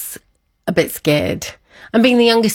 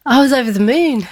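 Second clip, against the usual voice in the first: laughing happily, higher and louder; joyful laugh; 7 semitones higher, 4 dB louder than usual. Real, not acted. Telling the interviewer how she felt, laughing with joy. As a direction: let the joy spill out in laughter.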